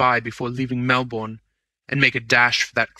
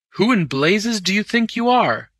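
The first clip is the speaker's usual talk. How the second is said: high, loud demand; angry challenge; 10 semitones higher, 5 dB louder than usual. A narrator's reading. Real manager challenging the stranger, angry demand. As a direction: challenge him angrily.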